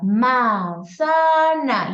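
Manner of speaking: "Manzana" split slowly into man-za-na; syllables drawn out. Shown as a demonstration. Teacher showing how a child repeats a word in syllables, very slow. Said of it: "lo va repitiendo así".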